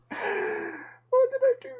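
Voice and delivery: high, slow wailing What did I do?; mock wail. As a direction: wail it like a lost soul.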